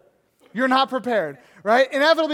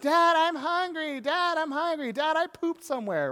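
put-on child voice; 6 semitones higher than usual. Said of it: "the other kids start coming out".

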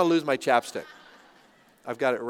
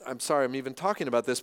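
ordinary talk, nothing marked

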